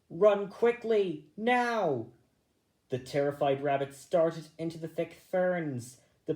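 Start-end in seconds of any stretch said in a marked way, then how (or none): 0.1-2.1 loud, higher, slower cry to flee; urgent warning. A narrator's reading. Bigwig warning the group, a loud urgent call. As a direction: urge them to run for their lives. Alarm at the dog.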